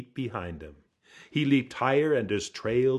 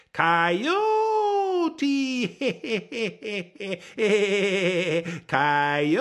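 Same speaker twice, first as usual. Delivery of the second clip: loud high howl with wild laughter; a trickster crowing his own name, cackling; howling Coyote; 12 semitones higher, 6 dB louder than usual. A narrator's reading. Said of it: "he howled back at himself".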